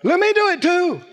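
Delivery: high, hammed-up eager plea; bold, eager. Bold disciple wanting to walk on water too, mock-eager. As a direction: pipe up high like an excited volunteer. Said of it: "And Peter is bold".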